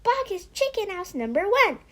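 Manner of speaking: fast high splutter. Acted. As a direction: blurt the whole name at once. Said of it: "spluttered the small fox".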